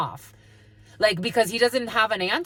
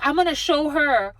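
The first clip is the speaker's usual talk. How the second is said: higher-pitched mimicked threat; mock defiance; 6 semitones higher, 4 dB louder than usual. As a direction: imitate someone set on proving a point. Mimics someone bent on revenge.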